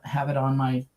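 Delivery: normal talking voice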